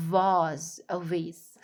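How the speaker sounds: first "vase" as vahz, second "vase" as vayss; "vase" both ways, vahz then vayss, long opening v; two pronunciations. Shown as a demonstration. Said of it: "الاتنين صح".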